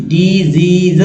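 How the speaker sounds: ordinary talk, nothing marked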